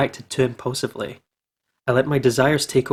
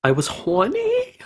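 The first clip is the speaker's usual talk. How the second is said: high drawn-out comic squeak; squeaky high emphasis; 11 semitones higher, 3 dB quieter, slower than usual. Acted. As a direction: jump way up and stretch horny.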